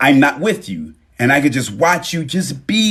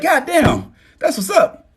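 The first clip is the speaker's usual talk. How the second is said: impressed lover; slower than usual. Retold or imitated. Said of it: "the love in your life be like".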